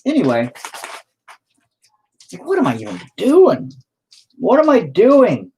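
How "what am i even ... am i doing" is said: exasperated. Real, not acted. Frustrated at the painting, louder higher whine. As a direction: wail at your own bad painting.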